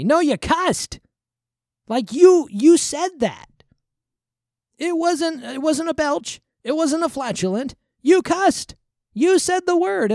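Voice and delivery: high, loud exasperated correction; worked-up, insistent rebuke; indignant scolding. Real, not acted. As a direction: call them out, pitch way up. Scolding those who say excuse me.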